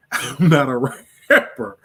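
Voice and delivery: laughing. Real, not acted. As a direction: laugh through the denial, amused. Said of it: "you should get on there and rap".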